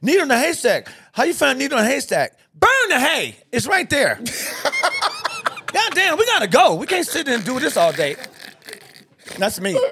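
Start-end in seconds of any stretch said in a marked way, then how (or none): 4.2-5.6 high burst of laughter; real high laugh; high cackle. Real, not acted. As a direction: cackle at your own punchline. Laughing at his own joke.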